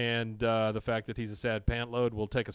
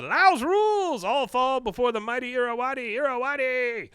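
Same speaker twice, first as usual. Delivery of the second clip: much higher, louder gloating cry; mock triumphant proclamation; 12 semitones higher, 5 dB louder than usual. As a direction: crow it like a hammed-up champion. Khan's triumphant boast, acted out.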